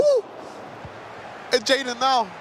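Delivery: excited. Real, not acted. Caster reacting to a swing.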